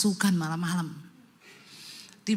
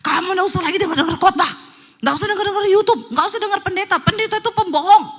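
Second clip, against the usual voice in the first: fast, loud, high-pitched tirade; red-eyed angry rant; 11 semitones higher, 9 dB louder than usual. Retold or imitated. Possessed husband forbids wife hearing sermons, loud angry rant. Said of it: "suaminya matanya merah ngomong ke istrinya".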